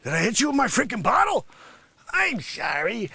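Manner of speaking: ordinary delivery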